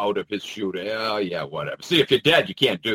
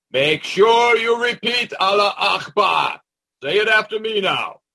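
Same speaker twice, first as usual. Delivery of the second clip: high, loud trainer giving orders; mock drill instructor; 8 semitones higher, 4 dB louder than usual. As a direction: call it out as a hammed-up instruction to a trainee. Acts out a trainer drilling the driver.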